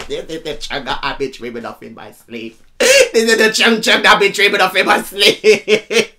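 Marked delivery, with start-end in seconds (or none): 2.8-6.2 slow, gleeful declaiming; laughing lyric quote. Retold or imitated. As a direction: belt the line out and break into laughter. Repeats lyric louder, laughing.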